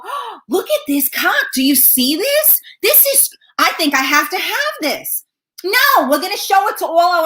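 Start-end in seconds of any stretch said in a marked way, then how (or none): 0.0-5.2 high, put-on gushing voice; mock sender. Mocking what senders imagine, high gushing mock voice. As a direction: gush over it in a silly voice. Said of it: "Like, you, you go".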